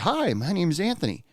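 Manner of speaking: put-on high, gentle hello to a baby; greeting a baby.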